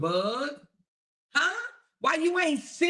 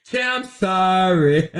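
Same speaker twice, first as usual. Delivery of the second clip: mock apology; 3 semitones lower, 7 dB louder, slower than usual. Mocking woman apologizing to chair.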